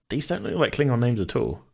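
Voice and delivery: ordinary talk, nothing marked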